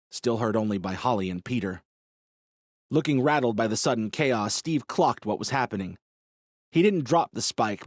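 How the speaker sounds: usual speaking voice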